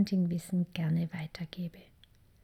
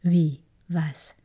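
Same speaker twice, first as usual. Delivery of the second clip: a sudden, louder Wie followed by a questioning Was; startled reaction; 2 semitones lower, 5 dB louder than usual. Retold or imitated. Parent hearing that crying need not be stopped, surprised. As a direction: voice the parents' shocked disbelief. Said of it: "So denkt jetzt vielleicht einige".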